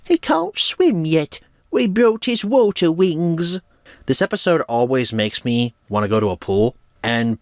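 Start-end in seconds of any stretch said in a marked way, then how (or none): 0.0-3.6 put-on Pig's accent, slow and high. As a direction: stretch out water in Pig's accent. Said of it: "fun to say in that accent".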